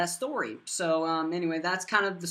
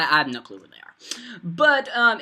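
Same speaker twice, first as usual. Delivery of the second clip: normal talking voice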